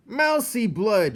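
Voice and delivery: high, loud, slow rat yell; rat shout. A narrator's reading. A hungry rat shouts about the mouse. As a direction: bellow it out hungrily. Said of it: "shouted one rat".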